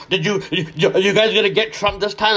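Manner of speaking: ordinary talk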